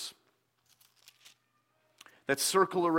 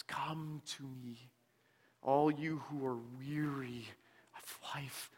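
soft call fading into a whisper; gentle beckoning that dies to a whisper; hushed call; 3 semitones lower, 10 dB quieter than usual. Acted. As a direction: call them in, barely above a breath. Christ calling out in sermon.

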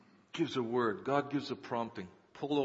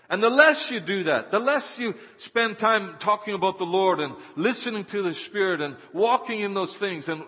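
raised, loud sermon delivery; impassioned preaching; 7 semitones higher, 8 dB louder than usual. Real, not acted. Preacher urging believers to heed the Spirit, impassioned preaching. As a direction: press each point on the congregation.

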